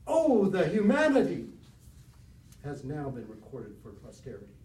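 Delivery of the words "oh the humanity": loud, high, anguished quote of the famous line; plaintive cry. Retold or imitated. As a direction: cry it out in grief. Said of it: "his plaintive words".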